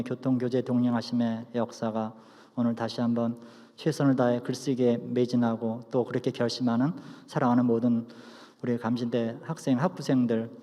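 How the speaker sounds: hushed, low benediction prayer. Acted.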